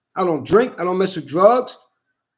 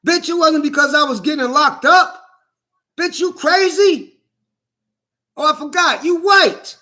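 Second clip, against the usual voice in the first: retold shouting; 3 semitones higher than usual. Man retelling how he yelled at the doctor, angry shouting. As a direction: yell it like you are losing it. Yelling at her in retold scene.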